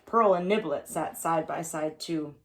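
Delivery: plain reading voice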